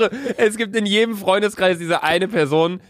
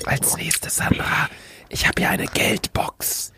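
slow hushed whisper; ASMR whisper; 5 dB quieter, slower than usual. Acted. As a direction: breathe it like soothing a baby. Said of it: "Okay, nochmal".

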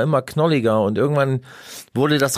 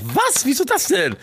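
high-pitched incredulous outburst, retold; startled disbelief; 11 semitones higher than usual. Patient told he must stay the night, retold startled disbelief. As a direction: jump up in pitch in mock shock.

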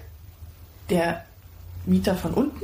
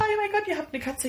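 excited gush; 12 semitones higher, faster than usual. Retold or imitated. Neighbor chat acted out.

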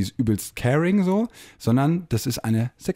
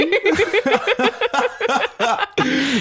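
high burst of laughter. Real, not acted. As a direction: let out a big high laugh. Loud laugh at Otis joke.

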